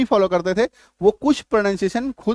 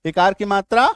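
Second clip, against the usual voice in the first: ordinary talk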